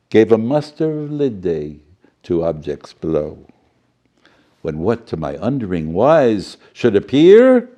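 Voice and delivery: lively, animated storybook reading; story narration. Acted. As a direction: read it like a showy children's storyteller, swooping up on big moments. Reads story with spoonerisms.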